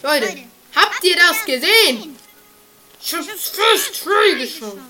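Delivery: excited bragging. Real, not acted. Boasting after success.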